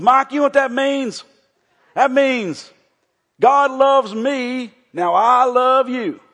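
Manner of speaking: put-on high, loud, drawn-out Tennessee accent; Tommy. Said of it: "Tommy really talks funny".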